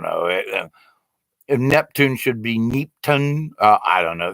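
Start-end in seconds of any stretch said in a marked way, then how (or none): 1.7-3.5 Neptune said normally, then slowly as NEEP-tun; mock mispronunciation. Shown as a demonstration. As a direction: stretch Neeptun with a long ee in the first syllable. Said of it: "folks are coming up with the damnedest".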